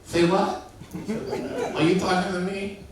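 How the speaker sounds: high, loud lisping mock Tyson; Mike Tyson impression. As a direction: do a hammed-up Tyson. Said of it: "he was like".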